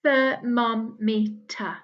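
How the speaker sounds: "Thermometer" drawn out slowly, every syllable clear; teacher stretching out "Thermometer"; slow syllable model. Shown as a demonstration. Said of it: "My turn".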